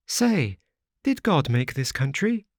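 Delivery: put-on high, childlike girl's voice; little girl. A narrator's reading. Little girl asks the wanderer if they will die, high childlike voice. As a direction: play a small frightened child asking.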